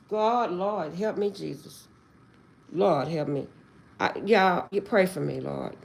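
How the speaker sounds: soft, sinking prayer for help; hushed plea. Real, not acted. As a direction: beg God quietly, voice dropping away. Overwhelmed, pleading to God for help.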